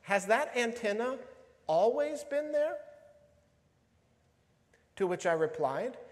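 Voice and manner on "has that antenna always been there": wife's question, pitched up high; retold wondering question. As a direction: lift it high, climbing to the end. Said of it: "she asks the question. She says".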